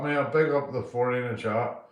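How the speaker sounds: ordinary delivery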